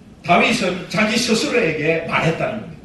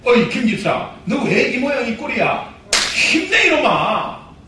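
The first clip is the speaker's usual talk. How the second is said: self pep talk; 3 semitones higher than usual. Acted. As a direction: pump yourself up like a coach. Preacher rallying himself as example.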